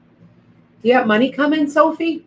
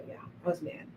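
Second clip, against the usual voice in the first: ordinary delivery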